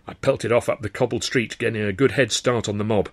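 ordinary delivery, nothing marked

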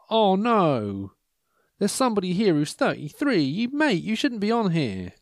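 mock dismay. Host spots a too-young lonely heart, mock dismay. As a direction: pitch it up in playful horror.

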